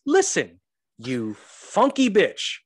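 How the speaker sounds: high, loud outraged defence; mock indignant rant. Dad telling off disgusted lady in Target, mock indignant. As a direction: tell her off, hammed-up and pitched high.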